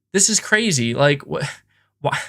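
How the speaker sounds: ordinary delivery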